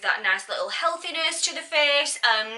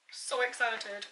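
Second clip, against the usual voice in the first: understated excitement; 10 dB quieter, slower than usual. Real, not acted. Vlogger on her lip colour, understated excitement. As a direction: say it softly, almost to yourself. Repeats the phrase two ways.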